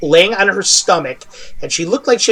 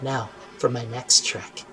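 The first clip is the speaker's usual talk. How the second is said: low, soft, far-off taunt; taunting archer; 7 semitones lower, 14 dB quieter than usual. Acted. Archer on the roof taunting Kale, low and soft. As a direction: purr it lower and softer, toying with him. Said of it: "looks over at Kale and goes".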